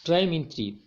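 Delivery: Tri punched and held; stress on Tri. Shown as a demonstration. Teacher explaining meter to students, stressing Tri. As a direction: lean on the word Tri.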